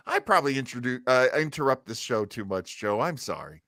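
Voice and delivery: ordinary talk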